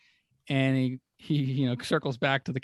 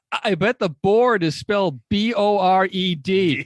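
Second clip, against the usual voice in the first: higher, louder mock Norm spelling out the pun; loud, gleeful spell-out of the joke; Norm's pun; 6 semitones higher, 9 dB louder than usual. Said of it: "you hear Norm goes".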